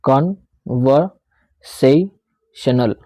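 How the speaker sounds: Conversational very slowly, broken into con, ver, sa, tional; slow teaching breakdown of conversational in separate pieces; syllable by syllable. Shown as a demonstration. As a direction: pause after each chunk of conversational. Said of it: "अजीब से word को मैं समझाने की कोशिश".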